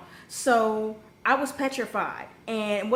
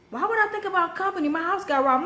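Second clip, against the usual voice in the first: fast, high-pitched indignant rant; 7 semitones higher than usual. Retold or imitated. Robbed daughter answering her mother, indignant. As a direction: fire back in disbelief, quick and high.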